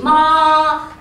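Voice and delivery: "ma" sung high, held perfectly flat; high level tone. Shown as a demonstration. Teacher modelling the high first tone, held flat and sung. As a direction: keep "ma" high and straight like opera. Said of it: "keep it very straight".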